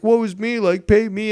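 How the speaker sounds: mock pity party. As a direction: whine like a beggar, overdone.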